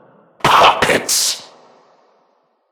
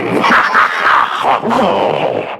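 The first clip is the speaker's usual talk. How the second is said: mocking laugh; 8 semitones higher than usual. Villain mocking the puppet.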